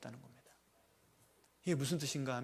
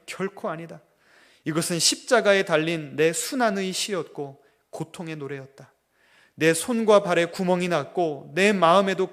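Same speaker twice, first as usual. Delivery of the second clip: God answering the grumbler, slow and solemn; 7 dB louder than usual. Retold or imitated. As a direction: speak as God, gently correcting. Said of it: "하나님께서 음성을 들려주시더래요".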